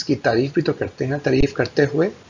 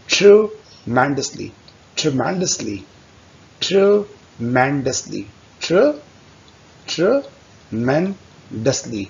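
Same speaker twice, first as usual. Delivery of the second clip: "tremendously" said very slowly, syllable by syllable; slow drill; much slower than usual. Shown as a demonstration. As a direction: spell it out slowly for learners. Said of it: "दो से चार, पाँच, सात बार repeat करें". (धीरे धीरे दोहराइए)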